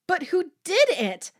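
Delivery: high, loud, upset question; cried out. A narrator's reading. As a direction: cry out for an answer. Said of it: "Corky cried".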